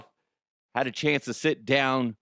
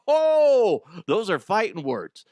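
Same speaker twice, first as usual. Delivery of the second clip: long, high, loud oh; mock outrage; 7 semitones higher, 5 dB louder, slower than usual. Interviewer reacts to a bird's answer, loud high mock shock. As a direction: whoop it, as if provoked.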